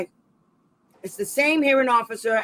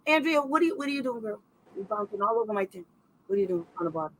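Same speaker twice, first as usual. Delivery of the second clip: softer, fond scolding of a restless pet; talking to the pet; 5 dB quieter, faster than usual. Real, not acted. Asking the pet bouncing around the tent, in a soft fond voice. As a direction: coax her like a pet owner.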